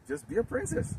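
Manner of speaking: high mocking lilt. Preacher teasing the sisters, high mock sweetness.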